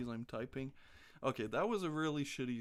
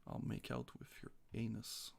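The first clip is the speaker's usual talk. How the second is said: whispered joke; 2 semitones lower, 8 dB quieter than usual. Real, not acted. Joking to stream chat in a whisper. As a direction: slip the crude joke out under your breath.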